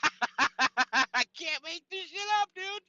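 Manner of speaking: helpless high, loud laughter through words; real laughing. Real, not acted. Storyteller in tears over Sauna Santa, laughing hard. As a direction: squeeze the words out slowly while cracking up. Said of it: "Sauna Santa has me in tears".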